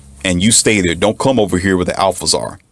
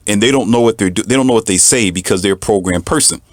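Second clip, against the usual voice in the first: ordinary delivery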